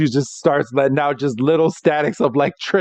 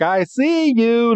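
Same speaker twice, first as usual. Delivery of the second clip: high, slow playful taunt; teasing callout; 7 semitones higher, slower than usual. Retold or imitated. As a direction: draw it out high, as if catching someone hiding. Pikachu spots the hidden villains.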